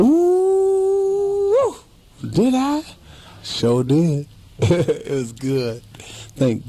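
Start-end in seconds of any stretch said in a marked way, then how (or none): 0.0-1.8 high delighted whoop. Real, not acted. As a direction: squeal with joy at the question. Delighted answer to interviewer.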